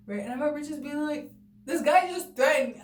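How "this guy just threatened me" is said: heated yell. Retold or imitated. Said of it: "so we're yelling back and forth".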